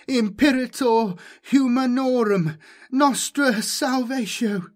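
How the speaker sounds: high, loud incantation howl; howled prayer. A narrator's reading. Jomi howls Farb's prayers against the demon, howling. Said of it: "recalled Farb's prayers and howled".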